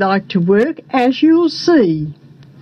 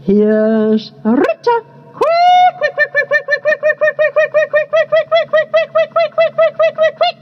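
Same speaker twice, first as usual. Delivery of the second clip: warning bird call; 14 semitones higher than usual. A narrator's reading. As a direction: chirp the alarm over and over, high. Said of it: "a spy saw the orchardist ... and warned".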